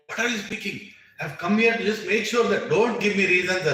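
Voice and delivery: high, loud indignant reply; indignant brother firing back; indignant retort. Retold or imitated. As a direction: snap back, offended. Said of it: "Arjuna is like".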